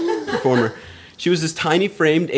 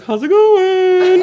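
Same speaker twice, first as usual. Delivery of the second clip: much higher, louder and slow sing-song check-in; drawn-out chirpy boss voice, hammed-up; mimicking Anna; 6 dB louder, much slower than usual. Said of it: "So she's like".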